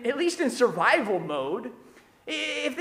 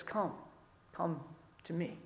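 tender invitation; 6 semitones lower, 15 dB quieter than usual. Retold or imitated. Pastor closes with Jesus' invitation.

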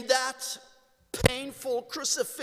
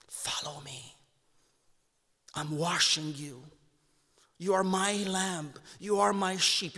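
gentle reassurance; 5 semitones lower, 5 dB quieter than usual. Acted. Jesus speaks gently to the sinner.

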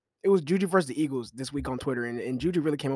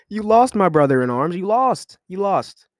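loud, high-pitched gloating that builds; crowing at a rival fan; gloating taunt; 5 semitones higher, 8 dB louder, slower than usual. Real, not acted. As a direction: rub the loss in his face. Taunting the losing receiver.

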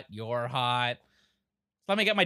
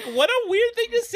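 much higher, laughing disbelief; incredulous laugh; 4 dB louder than usual. Retold or imitated. Co-host reacting to a weird line, squealing in laughing disbelief. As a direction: squeal it in amused shock. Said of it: "I was like".

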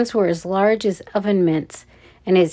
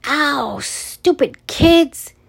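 higher, drawn-out angry yelp; pained outburst; 7 semitones higher, 4 dB louder, much slower than usual. A narrator's reading. Guard kicked in the shin, pained outburst. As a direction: cry out at the kick, then curse them.

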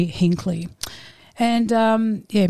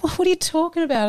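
high-pitched retold protest; indignant disbelief; 10 semitones higher, faster than usual. Student protests the teacher refusing the gift, indignant. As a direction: act out a student's baffled objection.

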